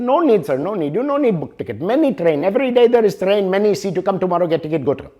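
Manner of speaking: high, brisk Indian English clerk voice, insisting; Indian English accent. Retold or imitated. Booth clerk in Bombay-bound story reassures traveler, high, cheerful Indian English accent. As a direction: play the Indian ticket clerk waving off the worry in his Indian English accent.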